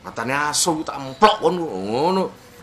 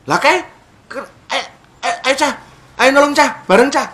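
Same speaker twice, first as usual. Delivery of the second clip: higher, eager call to the lads; rally the boys to help, mock-urgent; Kediri villager calling; 8 semitones higher than usual. Kediri villager calling friends to help the victim.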